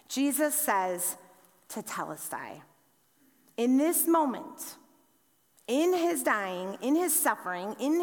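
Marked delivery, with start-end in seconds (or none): 1.7-2.6 "Tetelestai" said as tu-TEL-es-tai, lower and softer; Greek word said softly. Shown as a demonstration. Preacher teaching the congregation the Greek, soft reverent "Tetelestai". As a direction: lay the Greek "Tetelestai" down gently, syllable by syllable. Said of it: "is actually the Greek word tetelestai".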